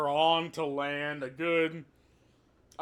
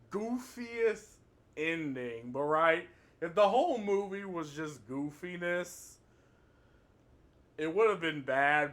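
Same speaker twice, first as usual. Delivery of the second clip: ordinary talk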